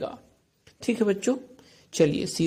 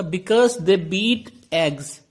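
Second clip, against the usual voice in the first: very slow, deliberate reading of the riddle; slow question read; much slower than usual. Shown as a demonstration. Teacher reading the riddle aloud to the class, very slowly.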